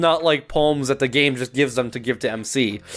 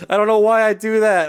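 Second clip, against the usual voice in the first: laughing; 6 semitones higher than usual. Real, not acted. Laughing at own misreading.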